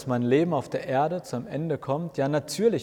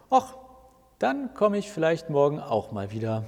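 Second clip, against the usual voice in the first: ordinary delivery, nothing marked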